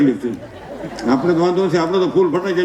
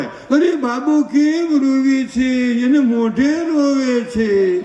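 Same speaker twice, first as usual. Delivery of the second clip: high, slow doting coo; cooing fuss over baby; 7 semitones higher, much slower than usual. Retold or imitated. Aunt fussing over the crying baby, high slow coo.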